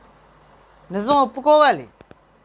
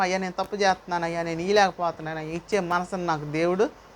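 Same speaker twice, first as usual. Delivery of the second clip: low, soft, hurried mock prayer; humble confession; 5 semitones lower, 6 dB quieter, faster than usual. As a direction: murmur a model confession to God. Preacher models a humble confession prayer. (వినయంగా, తగ్గు స్వరంలో ఒప్పుకోలు ప్రార్థన)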